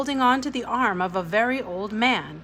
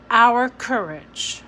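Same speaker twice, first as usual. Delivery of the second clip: Our hit loud and slow; stress on Our; 3 semitones higher, 6 dB louder, slower than usual. Acted. Ada correcting the poster, stressing Our.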